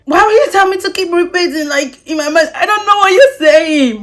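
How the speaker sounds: high, loud mock protest; acted-out exasperated outburst. Acts out exasperated protest to customer.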